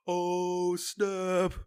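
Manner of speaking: high, drawn-out mock shock; hammed-up high-pitched amazement; retold shocked reaction. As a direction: act out a big plot-twist reaction. Acts out group's reaction to reveal.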